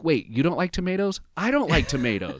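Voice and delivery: high, bright surprise at a shared quirk; excited discovery. Retold or imitated. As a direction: raise the voice with delighted surprise. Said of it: "this really fun clip where one of 'em's like".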